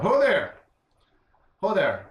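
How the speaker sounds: loud high hail across the road; yelling out. Acted. Ismark hailing the caravan with a loud shout. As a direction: call out to the approaching wagons. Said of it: "he yells out".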